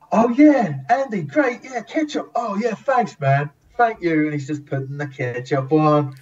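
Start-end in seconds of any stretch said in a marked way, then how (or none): 0.1-4.2 chirpy thanks. Retold or imitated. Kit Harington thanking him.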